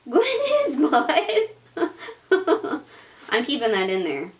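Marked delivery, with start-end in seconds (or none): 0.0-2.8 laughing. Real, not acted. Laughing at baby's toot.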